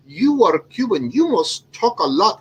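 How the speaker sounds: playful imitation of a lilting Indian accent. Retelling the client's funny remark in an imitated Indian accent. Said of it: "the way he said that".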